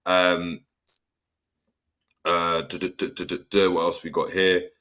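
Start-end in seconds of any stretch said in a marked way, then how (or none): none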